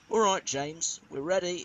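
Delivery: a loud call across the yard; calling back. A narrator's reading. Crew calls out to James they are ready, loudly. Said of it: "his crew called back".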